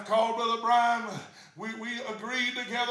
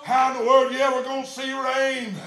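loud, driving revival preacher shout; full-force preaching; 3 semitones higher, 9 dB louder than usual. Real, not acted. Preacher telling the church God says expect rain, full-force preaching.

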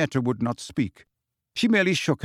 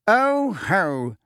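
gloating; 7 semitones higher, 3 dB louder than usual. Acted. Fudge gloats.

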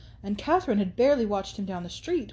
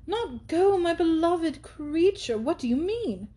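shocked friend; 8 semitones higher, slower than usual. Acted. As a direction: gasp in disbelief. Isabella shocked at refusal.

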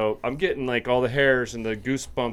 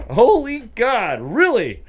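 loud, high, slow "Holy God"; floored at how it's said; astonished; 11 semitones higher, 7 dB louder, slower than usual. Real, not acted. Host reacts to the island's name with loud disbelief.

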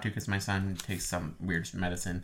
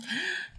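sharp high gasp; 8 dB quieter than usual. Real, not acted. Reacting to pun.

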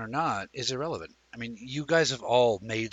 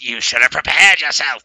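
louder, harder threat; more emphatic; 3 semitones higher, 14 dB louder than usual. Retold or imitated. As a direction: punch it out with more force. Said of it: "but this time more emphatic".

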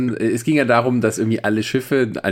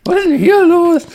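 put-on much higher, louder old-woman scolding; grumpy old woman; 7 dB louder than usual. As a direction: scold the young folks like a cranky granny. Old Pulaski scolding the young crew. (grantige alte Dame, hoch und laut)

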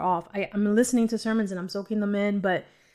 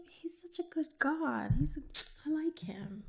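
a hushed, fond whisper; tender whisper; 5 semitones higher, 12 dB quieter than usual. Real, not acted. Confiding to listeners about Jesus in a soft whisper. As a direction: breathe it like a secret. Intimate aside about God.

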